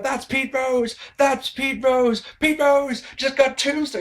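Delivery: hammed-up play-by-play yell; imitating Jim Ross. Reviewer quoting Jim Ross's famous call, high excited imitation. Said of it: "he shouted out".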